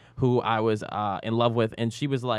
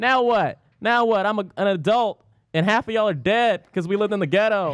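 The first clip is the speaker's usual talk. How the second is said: higher, louder taunting rant; mock defiant gloating; 6 semitones higher, 4 dB louder than usual. Grown-up taunting the classmates who hated him, mock gloating. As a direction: crow at old rivals, joking.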